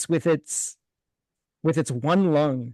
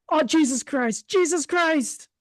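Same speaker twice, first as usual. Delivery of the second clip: high horrified outcry; high terrified exclamation; 13 semitones higher than usual. Acted. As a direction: recoil in horror. Howl from the cold locker.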